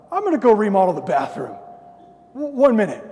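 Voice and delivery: loud, hurried put-on huff; hammed-up flustered excuse; mock agitation. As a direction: blurt it loudly, barely holding it in. Acts escape to vent in bathroom.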